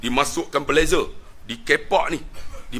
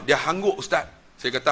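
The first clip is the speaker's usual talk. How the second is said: ordinary talk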